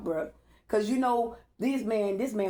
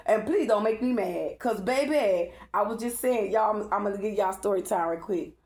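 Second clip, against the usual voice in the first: ordinary delivery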